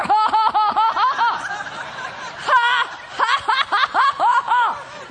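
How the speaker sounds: high rapid-fire ha-ha laugh; demonstrated belly laugh. Shown as a demonstration. Preacher choosing laughs over rowing, demonstrated belly laugh. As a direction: keep a big forced laugh rolling. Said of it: "Faking a laugh like I just did".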